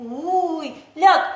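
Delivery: usual speaking voice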